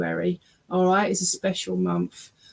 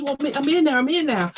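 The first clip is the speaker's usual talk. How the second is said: relayed excitement, higher pitch; excited; 6 semitones higher, faster than usual. Retold or imitated. Medium relaying a spirit announcing he's here, mildly excited. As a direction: voice his eagerness with raised pitch. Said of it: "And he's all excited".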